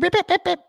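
high mock bleeping of a swear word; imitated censor beeps. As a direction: bleep it out with your voice, high and quick.

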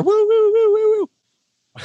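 high, loud police-siren wail; sing-song high siren noise; imitated siren. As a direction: whoop like a squad car siren. Imitating police sirens after lights everywhere.

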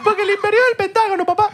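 extremely high, squeaky joke voice; squeaky mock proclamation. Friend repeating the Pentagon joke, very high squeaky proclamation.

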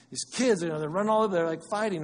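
mildly acted parental exasperation; fed-up parent. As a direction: complain like a parent over noisy kids. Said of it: "Parents, how many times you walk to and be like".

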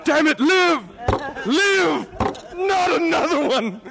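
a melodramatic mourner, squealing with laughter; mock anguished wail. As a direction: cry out to the heavens over the dead joke. Mock grief over a dead joke.

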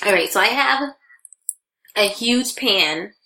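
ordinary talk

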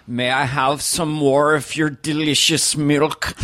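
slow, overdrawn German accent; exaggerated German accent. Shown as a demonstration. Demonstrating how hard to push it, exaggerated German accent. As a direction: stretch out every word in a German accent. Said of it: "to exaggerate that accent".

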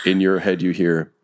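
usual speaking voice

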